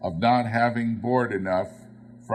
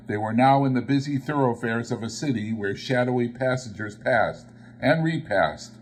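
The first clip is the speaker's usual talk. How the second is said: plain reading voice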